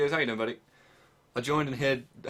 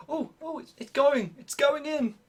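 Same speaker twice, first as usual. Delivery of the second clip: much higher, excited cries; excited squeal; 14 semitones higher, 4 dB louder, slower than usual. Real, not acted. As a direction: squeal with delight as it works. Excited as the part goes in.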